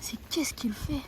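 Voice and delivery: slow hushed whisper, hiding; whispering while hiding. Acted. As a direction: whisper slowly so he won't hear. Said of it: "Cache-toi, cache-toi".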